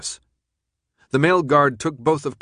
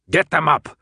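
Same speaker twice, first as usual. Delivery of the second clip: louder barked command; barked order; 5 semitones higher, 5 dB louder than usual. Acted. Jansen barks at the guards, commanding. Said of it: "Jansen barked".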